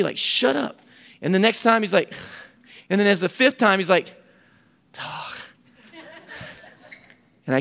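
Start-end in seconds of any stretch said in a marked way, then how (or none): 0.2-0.8 angry. Retold or imitated. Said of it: "like, angry". 4.9-5.4 whisper. Retold or imitated. Teen finally warming up, mutters.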